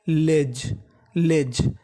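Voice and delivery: slow, careful "लेज"; teacher modelling the word "लेज"; model pronunciation. Shown as a demonstration. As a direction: say "लेज" slowly and clearly, like a teacher. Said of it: "लेज का मतलब होता है हद".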